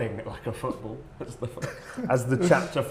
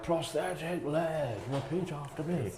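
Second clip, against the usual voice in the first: slow, softer drill with a falling end; a hint of a bored teacher droning it out; mock teacher drill; 4 semitones higher, 5 dB quieter, slower than usual. Teacher mimicking the textbook drill for friends, slow and droning. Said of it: "But it's like".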